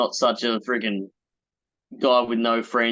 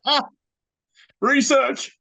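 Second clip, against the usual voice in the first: very high, teasing call; mock announcement; slower than usual. Co-host teasing the other about his notes, silly high-pitched call. Jokey jibe at co-host's notes.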